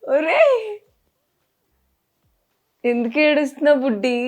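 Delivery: high, loud emotional plea; tearful wail. Real, not acted. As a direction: call out in dismay to a crying little one. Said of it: "మీరు ఏడుస్తున్నారు కాబట్టి".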